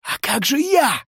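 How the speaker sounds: high-pitched wounded indignation; hurt, incredulous outcry; offended protest. A narrator's reading. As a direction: whine that you are being left out. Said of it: "обиженно сказал Горыша".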